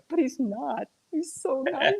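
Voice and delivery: high, gushing surprise; a high, delighted gasp of surprise; surprised gush. Retold or imitated. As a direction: gush over how nice he is. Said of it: "it's like".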